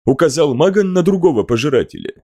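usual speaking voice

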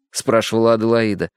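ordinary delivery

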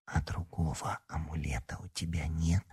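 hushed, secretive whispered question. A narrator's reading. Larisa asks Alyona for another amulet, whispering. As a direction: whisper it very softly. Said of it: "шёпотом поинтересовалась она".

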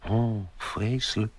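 whispered, breaking off near tears; close to tears. Acted. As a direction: barely get it out, about to cry. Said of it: "op de rand van huilen". (dochter fluistert, bijna in tranen)

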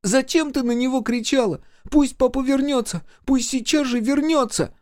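high-pitched wailing demands; tearful child. A narrator's reading. Микки demands mother bring father back, tearful. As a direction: plead and accuse as an upset boy. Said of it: "всхлипнул Микки".

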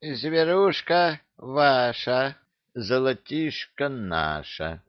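high, slow thin voice of a fat boss; grim words in a tiny voice; thin little voice. A narrator's reading. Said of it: "мрачно произнёс тоненьким голоском".

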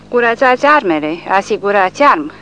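loud, high drill orders; crisp drill commands. A narrator's reading. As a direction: rattle them off like a trained sergeant. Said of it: "cu precizia felvebelului instruit".

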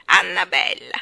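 loud exclamation of the name; bursting out. A narrator's reading. Starling delighted by the baby's name, loud outburst. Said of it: "proruppe nuovamente".

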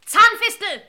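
loud, high outraged yell of the name; furious shout. Acted. Master yelling at the waiter who shot his dog. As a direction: bellow the name in fury.

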